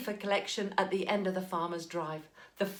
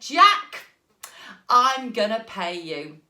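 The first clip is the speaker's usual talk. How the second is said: hearty farmer; 8 dB louder, slower than usual. Acted. Farmer delighted.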